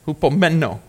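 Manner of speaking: Hupomeno with the stress on MEN; stress on third syllable. Shown as a demonstration. Preacher teaching congregation the Greek word, Hupomeno stressed on MEN. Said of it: "Emphasis on the third syllable".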